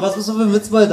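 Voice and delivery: normal talking voice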